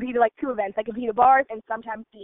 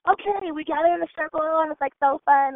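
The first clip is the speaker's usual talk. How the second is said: higher, upbeat team-huddle voice; excited retelling; 5 semitones higher, 3 dB louder than usual. Retold or imitated. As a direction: lift the pitch as if rallying the team.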